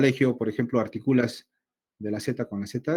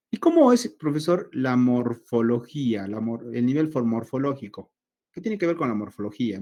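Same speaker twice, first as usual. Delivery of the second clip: mock student question; 3 dB louder than usual. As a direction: play the eager student. Teacher voices a student's question.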